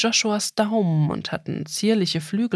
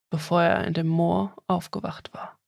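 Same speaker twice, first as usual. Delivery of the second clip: ordinary delivery, nothing marked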